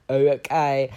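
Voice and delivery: imitated skeptical okay. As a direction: mimic an unconvinced supervisor. Mimics supervisor's doubtful reply.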